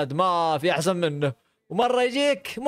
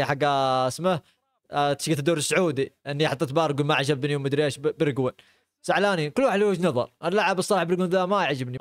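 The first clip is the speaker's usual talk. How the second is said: ordinary talk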